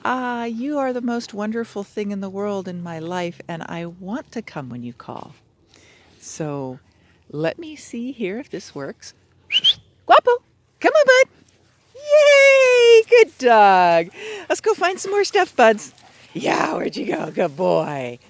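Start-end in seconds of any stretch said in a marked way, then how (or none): 0.0-5.3 squealed high joyful yay, then gushing; voicing the dog's delight; playful dog voice; mock dog's joy. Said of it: "You should say". 10.1-18.2 very high, loud, cheerful call to the dog; squealing happy praise to the dog; happy recall voice. Real, not acted. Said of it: "was a happy tone of voice".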